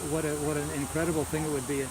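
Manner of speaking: usual speaking voice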